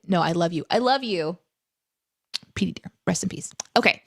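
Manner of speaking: ordinary delivery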